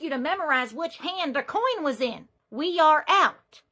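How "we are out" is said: slow, each word spelled out; firm slow repeat. Acted. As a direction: lay it down word by word. Repeating firmly to a customer.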